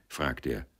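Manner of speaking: usual speaking voice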